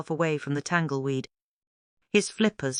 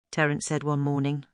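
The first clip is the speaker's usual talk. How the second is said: ordinary delivery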